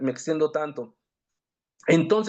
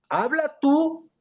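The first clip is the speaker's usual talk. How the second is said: high, slow plea from the frightened crowd; pleading people; 8 semitones higher, slower than usual. Acted. The frightened people beg Moses to speak instead, high and slow.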